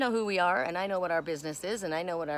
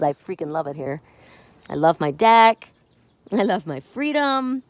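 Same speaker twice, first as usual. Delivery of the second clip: cat voice; 2 semitones lower, 9 dB louder than usual. Pretend. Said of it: "He goes".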